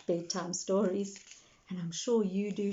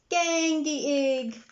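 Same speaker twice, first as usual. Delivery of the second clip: put-on high, slow toddler cheer; a small child mangling Granny Pig; 7 semitones higher, 5 dB louder, slower than usual. A narrator's reading. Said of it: "cheered George".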